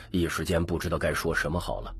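ordinary delivery, nothing marked

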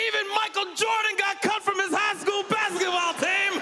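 triumphant shout. Retold or imitated. Lady answering the comic, high triumphant shout. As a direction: deliver the callback like a victory. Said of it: "And she goes".

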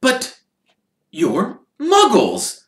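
high, slow and thrilled; delighted. Acted. Mr Weasley meeting Hermione's parents, high and delighted. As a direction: marvel at the discovery.

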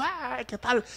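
high, soft mock wail; mock pulpit shouting. Preacher mocking noisy pulpit shouters, high soft mock yell. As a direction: mimic a noisy preacher without the volume. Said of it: "bochincheros en el púlpito y gritan".

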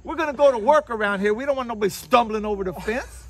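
high, loud mock scolding; bossy grandma. Grandma ordering grandchild to shape up, loud high impression. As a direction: bark orders in a hammed-up grandma voice. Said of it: "you might hurt your grandma's heart".

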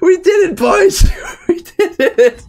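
very high, loud, then slow; real emotional cheer; tearful triumph. Real, not acted. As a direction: choke out the win. Overwhelmed after victory.

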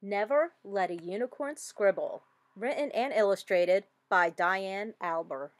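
plain reading voice

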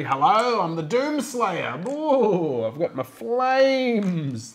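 loud, high, drawn-out hammy character voice; Doomslayer. Pretend. Introducing his fiery miniature to the table, hammed-up character voice. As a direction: ham it up, high and loud, stretching the last word. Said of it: "That's how he talks".

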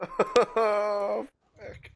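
laughing it off after a scare; shaky laugh, high and breathy; relieved laughter. Real, not acted.

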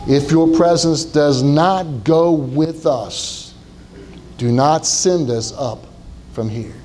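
very slow, low earnest plea. Acted. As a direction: stretch each word out like a vow. Moses pleading to God.

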